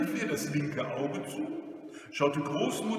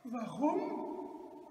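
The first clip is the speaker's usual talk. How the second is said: put-on soft, high old-lady question; puzzled little high voice; meek grandmother; 10 semitones higher, 6 dB quieter than usual. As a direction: ask timidly, high and small.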